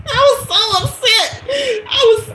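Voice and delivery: high, squealing laugh-talk; laughing hard. Real, not acted. Storyteller cracking up at how upset she was, laughing squeal. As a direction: squeal it out through real laughter.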